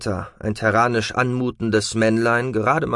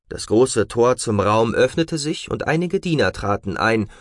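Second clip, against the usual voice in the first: plain reading voice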